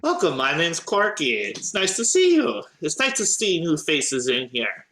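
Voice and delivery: high, friendly gnome voice; gnome cleric Corky. Acted. Young gnome introduces herself to new faces, high and friendly. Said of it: "looks at all of you and says".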